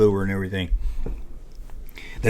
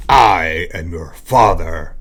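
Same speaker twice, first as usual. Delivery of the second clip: Vader impression; 7 semitones lower, much slower than usual. Famous Vader line quoted.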